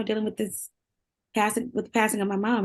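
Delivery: normal talking voice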